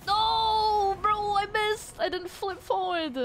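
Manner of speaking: high, loud cry of frustration at a missed shot; real gamer yell; frustrated yell. Real, not acted. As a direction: shout it out, annoyed at yourself.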